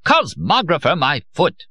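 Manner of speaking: shrieking fury at a fool; screaming rage. A narrator's reading. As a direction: scream it at a bumbling colleague. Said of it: "he screamed at Nunes".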